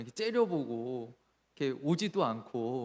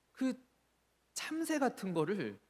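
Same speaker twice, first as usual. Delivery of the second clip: ordinary talk, nothing marked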